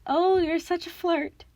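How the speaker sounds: high, coy bride voice; falsetto. A narrator's reading. Grover plays bride to the monster, coy falsetto. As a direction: flirt in a put-on falsetto. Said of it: "Grover said in his falsetto voice".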